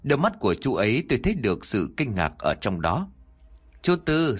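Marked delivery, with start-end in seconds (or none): 3.8-4.4 bright call out. Acted. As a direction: call to the guard across the lot. Calling the security guard. (gọi chú bảo vệ, giọng cao và to)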